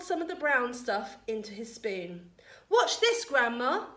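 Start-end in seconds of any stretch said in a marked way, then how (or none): 2.7-3.9 put-on high, loud boy's call; shouting. A narrator's reading. George showing off to Grandma on the roof, shouting. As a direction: call up to get her attention. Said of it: "he shouted".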